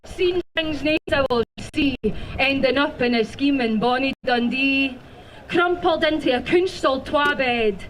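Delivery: usual speaking voice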